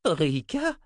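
high-pitched astonished question; old wizard taken by surprise. A narrator's reading. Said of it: "s'étonna le vieux sorcier".

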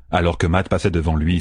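ordinary delivery

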